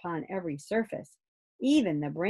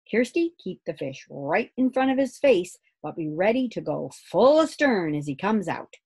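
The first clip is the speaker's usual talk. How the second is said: a captain's firm, louder orders; commanding father; 3 semitones higher, 5 dB louder than usual. Acted. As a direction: give the orders like an old ship's officer. Father takes charge of the lift.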